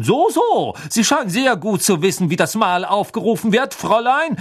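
high, scornful suspicion; mock-impressed, biting interrogation; sarcastic accusation. A narrator's reading. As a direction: insinuate that she is guilty. Crouch disbelieves and accuses Hermine.

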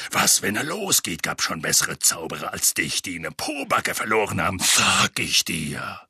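low, rough scolding; thundering Moody. A narrator's reading. Moody warning Harry about his wand, gruff thundering. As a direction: warn him in a rough growl, drawing out the end. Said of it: "donnerte Moody".

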